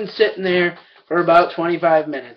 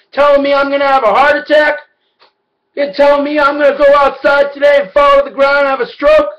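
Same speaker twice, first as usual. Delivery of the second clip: much higher and louder, outraged; furious yell; 9 semitones higher, 6 dB louder than usual. Retold or imitated. Retelling yelling at the cashier over her remark, loud outraged yell. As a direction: bark it at her, offended.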